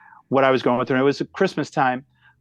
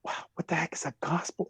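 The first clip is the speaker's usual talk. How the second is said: breathy whisper, baffled; whispered aside; 10 dB quieter than usual. Retold or imitated. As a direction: whisper it under your breath, confused. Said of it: "I'm looking at her going".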